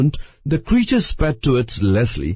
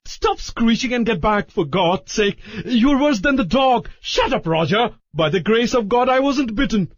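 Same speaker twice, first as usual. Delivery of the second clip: high, exasperated yelling; furious Larry; 12 semitones higher than usual. A narrator's reading. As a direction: yell at everyone to shut up. Larry yelling amid the chaos.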